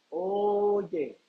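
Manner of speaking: "oye" held long for emphasis; drawn-out OYE. Real, not acted. Preacher completing the verse for the congregation, drawn-out "oye". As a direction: stretch the first vowel of "oye" out long.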